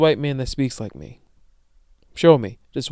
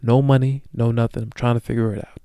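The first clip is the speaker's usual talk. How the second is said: usual speaking voice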